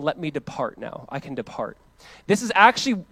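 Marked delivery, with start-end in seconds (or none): 0.0-1.8 much softer, peaceful letting go; soft release. Retold or imitated. Simeon ready to die in peace, much softer release. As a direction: sigh it out, at peace. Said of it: "he's just saying".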